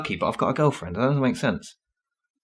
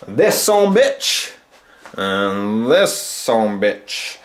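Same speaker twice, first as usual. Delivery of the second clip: drawn out; much slower than usual. Acted. Exaggerated drawn-out phrase.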